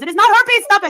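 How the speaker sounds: high, loud retold outburst; loud mock-indignant denial; exasperated mock scolding. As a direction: imitate an exasperated doctor shutting it down. Acting out the doctor's exasperated reply.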